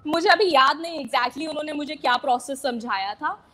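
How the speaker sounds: usual speaking voice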